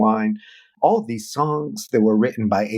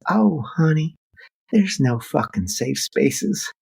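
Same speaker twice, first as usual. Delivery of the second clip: higher, slow sweet Southern granny drawl; 6 semitones higher, slower than usual. Retold or imitated. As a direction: play a sweet old Southern granny cursing gently. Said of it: "sweet Southern granny voice".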